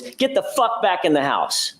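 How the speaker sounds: retold enraged order, a mild mock yell; voicing a furious command, lightly; understated angry order.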